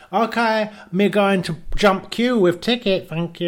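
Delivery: high, pleased broken English; smug little announcement; mock Kim. A pal mimics Kim, high and smug. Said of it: "He says".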